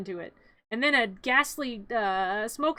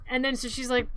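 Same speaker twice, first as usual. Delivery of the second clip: usual speaking voice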